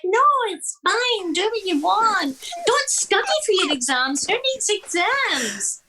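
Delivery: high, slow, airy mock-parent voice; sing-song the permissive parent, slowly. Daughter mocking her laid-back parents, high and slow. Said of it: "They're like".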